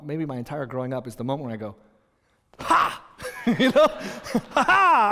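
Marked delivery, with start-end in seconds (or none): 2.6-3.0 loud, high taunting "ha!"; a sudden triumphant shout of "ha"; gloating "ha!". Retold or imitated. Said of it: "I did it like that in the game". 4.5-5.1 loud, high mocking "ha ha!"; gloating "ha ha!". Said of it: "I did it like that in the game".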